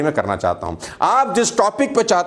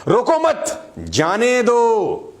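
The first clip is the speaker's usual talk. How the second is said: "रोको मत" high as one phrase, pause, then drawn-out "जाने दो"; pause after मत; 8 semitones higher, much slower than usual. Shown as a demonstration. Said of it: "मत पे मैंने फुल स्टॉप लगाया, कोमा लगाया". ("रोको मत" के बाद ठहराव)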